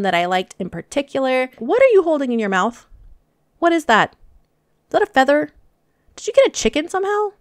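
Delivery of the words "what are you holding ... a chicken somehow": high, startled questions; suddenly higher, amazed; surprised. Real, not acted. Fox holding feather in mouth.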